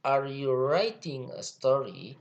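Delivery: slow, lifted "writing", rising at the end of "story"; question intonation. Shown as a demonstration. Teacher showing students how questions sound, questioning intonation on "writing a story". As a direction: ask "Are you writing a story?" slowly, with a clear up-and-down question tune. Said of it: "ada intonasinya".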